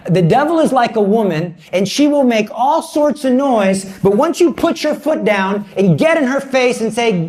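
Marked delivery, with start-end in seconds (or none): none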